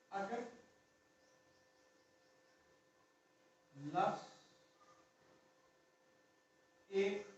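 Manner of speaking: each word dropped one at a time with long gaps, for students to write down; slow dictation. Acted. Teacher dictating the shorthand rule to students, slow and measured. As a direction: spell out the rule slowly so the class can copy it.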